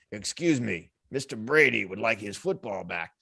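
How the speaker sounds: low, soft mob henchman; quiet, low-pitched request from a mobster's go-between; Sopranos mobster. Acted. Henchman asks fan for football back, low mobster voice. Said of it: "it's a scene from The Sopranos".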